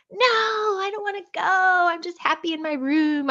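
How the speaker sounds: high-pitched mock child's protest; imitated reluctant child, stretched vowels. Retelling own childhood refusal to parents.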